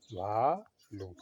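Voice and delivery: "भालू" stretched very slowly, each syllable held; slowly drawn out. Shown as a demonstration. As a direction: draw out "भालू" so the class can repeat it.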